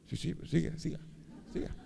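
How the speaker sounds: hushed, impatient prodding; impatient aside. Retold or imitated. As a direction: urge him on under your breath. Impatient inner aside while others applaud.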